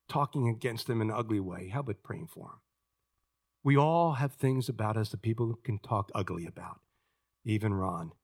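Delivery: usual speaking voice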